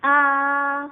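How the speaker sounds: loud, sustained A, high and unwavering; sing-like flat first-tone A; high level tone. Shown as a demonstration. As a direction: hold A long on a high, level pitch. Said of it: "itu datar tapi tinggi".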